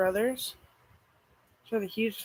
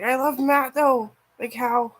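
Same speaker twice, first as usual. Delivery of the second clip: excited gush; 6 semitones higher, 10 dB louder, slower than usual. Real, not acted. Excited gush about Matt.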